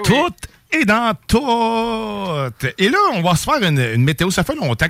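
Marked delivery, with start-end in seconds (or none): 0.1-2.7 very slow, stretched-out echo; drawn out. Acted. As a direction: savour every word slowly. Echoes it drawn out.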